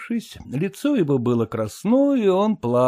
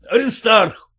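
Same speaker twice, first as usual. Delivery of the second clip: loud call across the house; 7 semitones higher, 5 dB louder than usual. A narrator's reading. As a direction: call the son sharply. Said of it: "крикнул он сына". (отец громко зовёт сына)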